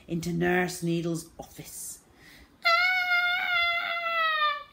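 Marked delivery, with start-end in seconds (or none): 2.7-4.7 very high, long squeal; terrible scream. A narrator's reading. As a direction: scream shrilly without stopping. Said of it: "came the terrible screams".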